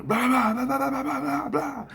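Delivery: high-pitched gibberish argument; squealed mock quarrel babble; mock arguing. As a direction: imitate someone arguing in high, run-on blahs. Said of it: "but he's arguing".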